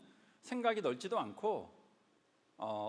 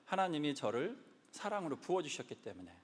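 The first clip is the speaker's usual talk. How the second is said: ordinary delivery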